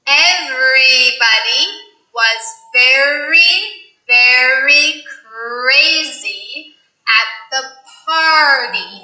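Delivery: very、crazy、party 的 R 都夸张加重; slow, exaggerated R in every word; heavy r. Shown as a demonstration. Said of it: "I will really exaggerate the R's".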